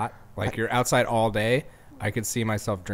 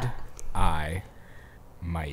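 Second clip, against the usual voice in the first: "Good. Eye. Mate." with a full stop after each word; "Good eye, mate" in separate, lower words; stopping between words; 4 semitones lower, 5 dB quieter, much slower than usual. Shown as a demonstration. Co-host avoiding the accent, "Good eye, mate" with periods. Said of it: "You're stopping. You're putting periods".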